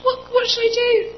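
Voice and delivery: high, loud, desperate question; panicky. Retold or imitated. Asking mum what to do, high desperate panic. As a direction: plead for an answer. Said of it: "And I was like".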